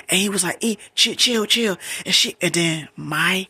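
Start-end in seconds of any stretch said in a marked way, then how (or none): 0.6-1.8 high, loud acted chill-out; urgent retold hushing. Boyfriend cutting off her questions on the phone, urgent. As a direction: imitate him frantically trying to calm her.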